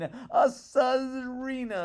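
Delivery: high, loud, drawn-out wailing cry; wife wailing her royal demand; greedy wife's demand. A narrator's reading. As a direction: stretch out the word in a greedy whine.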